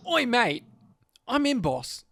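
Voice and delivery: British-tinged Australian accent; too-British Australian. Shown as a demonstration. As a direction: attempt normal Australian, land too British. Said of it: "I got too British".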